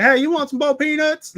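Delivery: high, loud Southern accent impression; imitated Southern uncle. Imitating the uncle offering boiled peanuts, Southern accent. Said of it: "that's how he talks, legitimately".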